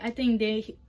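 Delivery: ordinary talk, nothing marked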